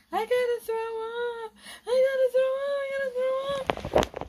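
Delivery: high, loud panicked cry. Real, not acted. Sick child cries to mom she must throw up, high and panicked. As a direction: cry out in panic about to vomit.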